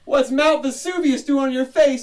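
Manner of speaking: high, loud mock Jersey heckle; blunt Jersey guy. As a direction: bust his chops like a Jersey guy. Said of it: "They're like".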